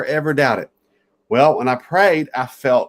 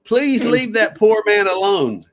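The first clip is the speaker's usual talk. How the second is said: mother calling out high and sharp, slowing at the end; a mock call across the café, pitched well up; mother yelling; 8 semitones higher than usual. Mother scolds Omar from her table, high mock call. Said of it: "I hear this woman yelling".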